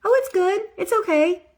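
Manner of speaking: high, loud, dismissive "it's okay" voice; mock settling. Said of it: "'Cause I don't want".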